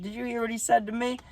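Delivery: high-pitched, pleading protest, kept soft; mock indignant protest. Young catcher appealing to the umpire, mock indignant. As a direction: imitate a kid's disbelieving appeal, voice way up.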